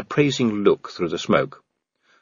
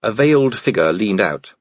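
usual speaking voice

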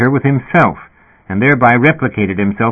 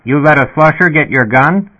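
higher-pitched, lightly voiced dispatcher on an intercom; sputtering intercom speaker; 6 semitones higher, 3 dB louder than usual. A narrator's reading. Dispatcher to the cop about the lost gun, sputtering. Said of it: "The intercom speaker sputtered".

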